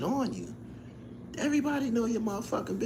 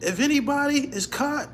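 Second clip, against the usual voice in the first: mock Trump proclamation, loud and forceful; booming declaration, laughing at the end; loud retelling; 6 semitones higher, 5 dB louder than usual. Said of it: "He said".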